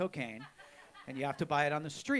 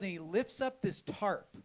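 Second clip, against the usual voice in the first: ordinary talk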